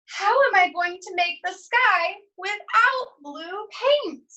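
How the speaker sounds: put-on high, puzzled little girl; high worried girl voice. A narrator's reading. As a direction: pitch climbing up in a young girl's fretful question. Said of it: "she says".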